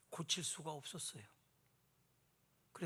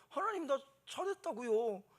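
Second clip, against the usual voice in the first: mock boast; 8 semitones higher, 3 dB louder than usual. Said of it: "큰 소리로 그렇게 말하면서".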